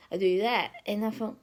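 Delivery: normal talking voice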